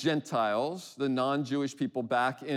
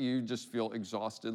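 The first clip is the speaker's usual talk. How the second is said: ordinary talk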